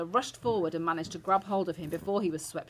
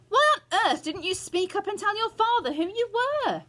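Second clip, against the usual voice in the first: high, loud reproach; exasperated scolding; 6 dB louder than usual. A narrator's reading. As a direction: grandmother telling off a boy. Grandmother scolding Bruno.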